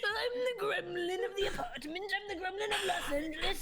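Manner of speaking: high-pitched boastful gremlin. Acted. Guest as gremlin of Los Angeles, in gremlin voice. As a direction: announce yourself as a high, silly gremlin. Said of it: "I'll do a gremlin voice".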